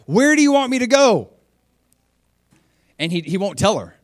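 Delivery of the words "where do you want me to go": loud, very high-pitched exasperated plea; exasperated mock cry. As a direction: act out crying up to God, much higher and louder. Retold missionary exasperated with God.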